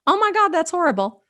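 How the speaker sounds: high-pitched pretend dismay; mock horror. What she doesn't want listeners saying, mock horror. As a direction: exaggerate a sympathetic listener's shock. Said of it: "not to be like".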